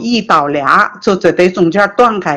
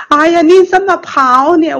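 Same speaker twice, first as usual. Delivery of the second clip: high, raised voice, puzzled 哎呀; brother calling out; 9 semitones higher than usual. Retold or imitated. Brother shouting to his running sister, high and surprised. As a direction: call after her, surprised she ran. Brother asks why she ran.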